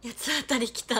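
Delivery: mock tantrum. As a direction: announce the tantrum with a burst.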